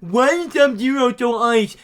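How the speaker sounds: loud, slow, high mock complaint; exaggerated baffled moviegoer voice; whining moviegoer. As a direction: drag out a mocking dumb question. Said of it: "If you go in there like".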